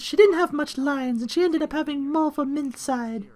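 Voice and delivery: high, put-on mother voice; lightly voiced anime mom; mom character voice. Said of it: "lower pitch".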